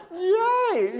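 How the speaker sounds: cheer. Retold or imitated. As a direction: squeal like a delighted grad student. Cheering at advisor's praise.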